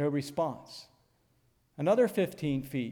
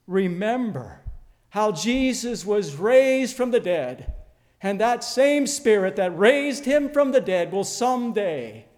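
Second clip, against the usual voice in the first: full-force preaching; 4 semitones higher, 6 dB louder than usual. Acted. Preacher urging his congregation to remember, loud and fervent. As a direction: drive it home to the congregation.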